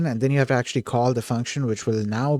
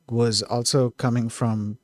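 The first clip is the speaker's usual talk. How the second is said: ordinary delivery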